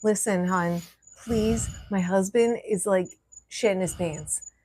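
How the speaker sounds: soft, slow, hushed plea to a stranger. Pretend. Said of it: "I would have been like".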